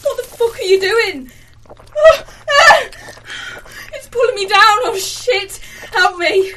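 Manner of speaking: screaming in panic as she sinks; sinking in quicksand. Acted. Being pulled down by plant.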